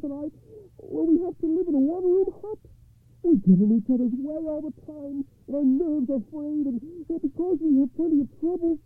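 high-pitched wailing poor man; desperate, high-voiced complaint; crying out in distress. A narrator's reading. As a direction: lament your troubles in a very high voice. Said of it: "he cried".

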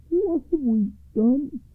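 high, slow lament; son crying out. A narrator's reading. Youngest son over his fallen mother, slow high cry. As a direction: wail it slowly, pitched high. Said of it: "cried the youngest son".

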